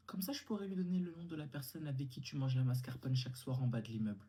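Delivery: ordinary talk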